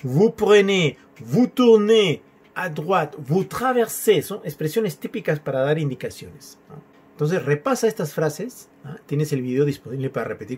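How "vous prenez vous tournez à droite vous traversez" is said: "Vous prenez, vous tournez", each phrase pitched high; higher, slow sing-out of each "vous" phrase; French speaking style. Shown as a demonstration. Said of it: "Utiliza el estilo, la manera de".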